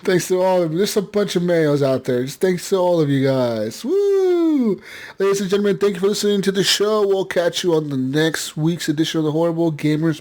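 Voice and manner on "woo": high whooping cheer; excited whoop. Real, not acted. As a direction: let out a big happy woo. Outro cheer.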